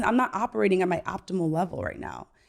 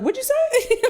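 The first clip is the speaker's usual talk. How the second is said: shocked mom. Retold or imitated. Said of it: "I was like".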